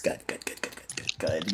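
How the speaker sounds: rapid whispered good good good; whispering. Real, not acted. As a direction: whisper it in relief. Relieved under-breath muttering.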